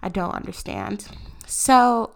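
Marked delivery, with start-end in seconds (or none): none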